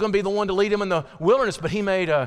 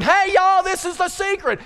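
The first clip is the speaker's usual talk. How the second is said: high, loud all-caps holler; God's audio version, hollered emphatically; God shouting emphatically; 11 semitones higher, 5 dB louder than usual. Acted. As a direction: call it out like an announcement in capital letters. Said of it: "it's God going".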